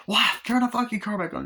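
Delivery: high-pitched acted exasperation; incredulous, high mock outburst; outraged demand. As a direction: play a caller at the end of her rope. Retold argument with car operator.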